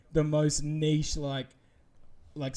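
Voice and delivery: normal talking voice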